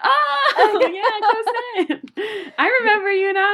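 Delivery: very high, loud, laughing burst of recognition; excited recognition. Retold or imitated. Acquaintance finally placing Ani by her handle, gushing. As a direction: gush with sudden delight.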